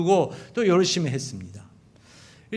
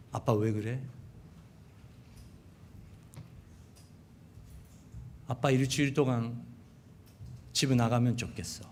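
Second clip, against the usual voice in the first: quiet cold rebuke; 5 semitones lower, 9 dB quieter than usual. Retold or imitated. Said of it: "첫째가 저에게 이렇게 말했어요".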